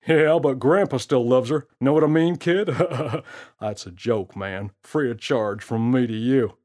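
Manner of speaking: put-on smooth-talking prankster teasing the kid; gorilla's voice. Gorilla jokes and laughs.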